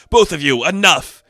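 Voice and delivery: loud commanding bark to stop them; commanding shout. Retold or imitated. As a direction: halt the fight with a loud shout. Megatron's voice stops the fight.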